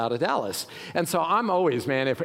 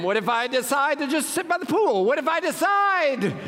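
anxious what-ifs; 10 semitones higher than usual. Pretend. Preacher mimicking a fretful traveler, high and anxious. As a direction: ham up a nervous overpacker. Mocking anxious overpacker worries.

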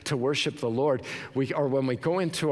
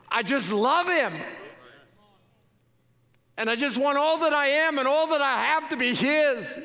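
building preaching; 6 semitones higher than usual. Acted. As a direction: swell from tender to fervent.

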